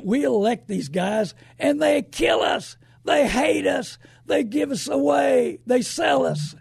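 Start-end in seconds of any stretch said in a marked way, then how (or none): 1.6-6.5 angry shout. Real, not acted. Radio host ranting at politicians.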